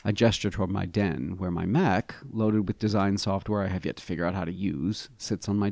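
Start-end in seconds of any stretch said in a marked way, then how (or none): none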